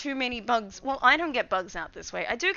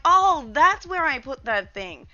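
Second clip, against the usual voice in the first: mock surprise; 4 semitones higher, 9 dB louder, slower than usual. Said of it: "I'll find things and be like".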